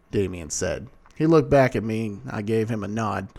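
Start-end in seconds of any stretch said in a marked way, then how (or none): none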